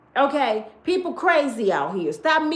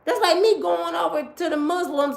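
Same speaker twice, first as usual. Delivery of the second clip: ordinary talk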